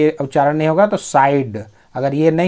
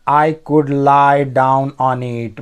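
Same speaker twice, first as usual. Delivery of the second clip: very slow, word-by-word English; slow dictation pace; slow reading; much slower than usual. Shown as a demonstration. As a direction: read each word out slowly for learners. Teacher reads story line aloud.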